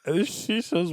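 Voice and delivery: usual speaking voice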